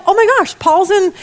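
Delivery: high, excited gasped quote; mock amazement. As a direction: imitate a listener who is amazed. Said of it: "And you're like".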